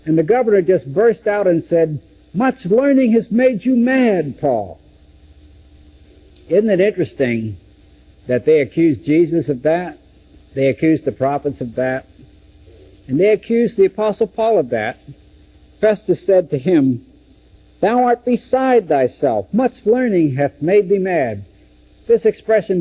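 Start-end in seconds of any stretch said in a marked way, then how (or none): 2.3-4.8 outburst. Acted. Festus bursts out in accusation. 17.8-21.4 accusing exclamation. Acted. Festus accuses Paul, quoted KJV.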